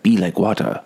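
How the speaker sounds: brief put-on accent, R dropped from water; Bruce Lee. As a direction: lightly mimic a film star's accent. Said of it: "I shouldn't do the accent".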